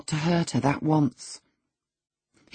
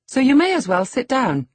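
high, loud, cheeky command; defiant; 11 semitones higher, 6 dB louder than usual. A narrator's reading. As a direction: tell him off, pitched right up. She defies the master.

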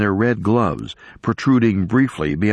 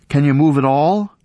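louder, higher call into the dark; 7 semitones higher, 5 dB louder than usual. A narrator's reading. Sarah calling down to Peter, raised voice. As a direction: shout down to reach him.

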